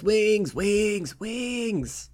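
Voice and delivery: high chanted shouts, fading away; crowd shouting. Acted. Rowdy customers all demand wings, shouting. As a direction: play a whole table hollering, trailing off. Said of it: "Everybody shouts".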